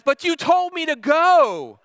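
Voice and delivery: high, loud, drawn-out wail; re-enacted daughter's indignant shout; yelled protest. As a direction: yell it back, exasperated. Daughter yells back in acted quarrel.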